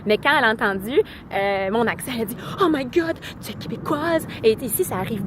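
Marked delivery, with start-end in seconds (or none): 2.6-4.2 high-pitched, thrilled exclamation; imitated excited recognition. Vlogger quoting new acquaintance hearing a Québécois accent, excited recognition. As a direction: mimic someone delightedly placing an accent.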